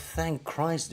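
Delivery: normal talking voice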